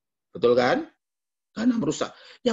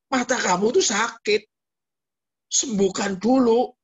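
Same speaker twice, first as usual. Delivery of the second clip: higher, louder stern command; a hint of stern divine voice; 8 semitones higher, 4 dB louder than usual. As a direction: Tuhan menegur, sedikit lebih tinggi dan keras. Preacher voicing God's rebuke.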